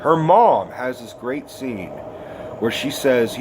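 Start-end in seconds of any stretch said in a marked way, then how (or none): none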